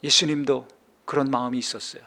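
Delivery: usual speaking voice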